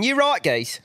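high-pitched English accent, cheeky badger. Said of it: "a badger running around in England going".

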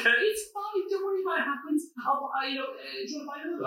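very high-pitched female impression; imitated girl. Girl reassures him about the drink, high imitated female voice. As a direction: put on a petite girl's high little voice. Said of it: "And she was like".

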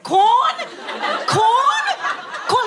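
put-on high, wailing "Quorn" like a lost child; hammed-up wailing "Quorn"; lost child crying. Shown as a demonstration. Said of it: "separated from its parents in a war".

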